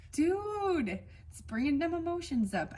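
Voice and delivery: fighting back real tears mid-sentence; choked up. Real, not acted. Reading for Tauruses, she gets choked up on air. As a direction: voice jumps high, close to tears. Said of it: "I can't cry and communicate with you guys".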